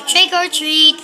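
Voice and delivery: loud, firm call. A narrator's reading. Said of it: "He said a little louder".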